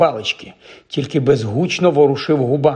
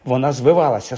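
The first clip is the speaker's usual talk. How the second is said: ordinary delivery, nothing marked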